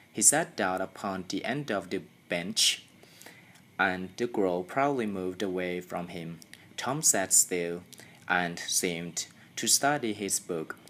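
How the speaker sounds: ordinary delivery, nothing marked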